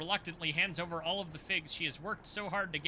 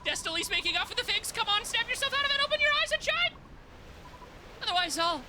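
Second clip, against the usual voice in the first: put-on urgent fairy girl; 14 semitones higher than usual. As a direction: rush her to wake up.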